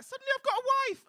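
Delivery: high, loud, fast squeal; hammed-up high rushed excuse; mock panicked punter. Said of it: "You're like".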